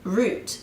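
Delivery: "route" said like "root"; British "route" with an oo vowel; British pronunciation. Shown as a demonstration. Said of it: "is the way a Brit".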